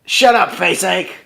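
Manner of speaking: slow, drawn-out, lightly put-on Murdoch voice. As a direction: drag the insult out in a mild Murdoch impression. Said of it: "You do the Murdoch voice".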